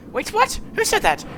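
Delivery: ordinary talk, nothing marked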